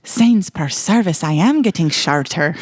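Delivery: put-on movie line in amateurish Irish accent. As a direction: draw it out in a mock Irish brogue. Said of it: "he says it with an Irish accent".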